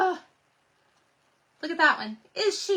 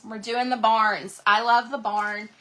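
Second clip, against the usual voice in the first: ordinary talk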